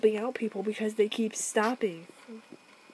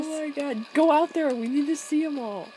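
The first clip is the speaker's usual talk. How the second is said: excited gamer; 5 semitones higher, 7 dB louder than usual. Real, not acted. Excited about tornadoes.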